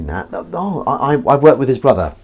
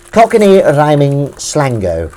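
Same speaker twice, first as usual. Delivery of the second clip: slow, louder mangled slango ending; playful mock cockney; 4 semitones higher, 6 dB louder, slower than usual. Shown as a demonstration. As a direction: ham up the silly -o ending.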